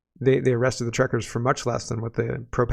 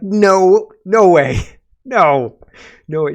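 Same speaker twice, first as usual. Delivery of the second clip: loud, high cry of disbelief with a laugh; shocked disbelief; 7 semitones higher, 12 dB louder, much slower than usual. Retold or imitated. Host recalling watching a climbing documentary twist, shocked disbelief. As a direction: re-enact being floored, belting it out.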